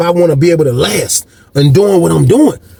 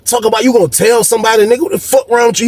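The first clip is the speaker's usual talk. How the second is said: irritated telling-off; 7 semitones higher than usual. Retold or imitated. Tom's acted-out telling off.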